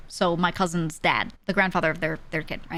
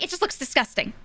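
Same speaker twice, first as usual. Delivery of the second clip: higher-pitched, imitated revulsion; mock disgust; 6 semitones higher than usual. Wife answering husband about the sausage, mock disgusted. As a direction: say it as a squeamish onlooker.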